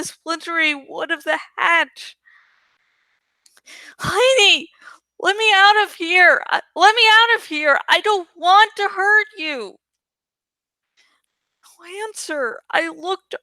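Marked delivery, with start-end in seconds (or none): 4.0-9.8 louder and higher, pushing faster on the repeat; trapped boy calling up to the old woman, louder and pleading; desperate plea. A narrator's reading. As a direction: yell up through the hatch to be let out. Trapped, pounding on the hatch.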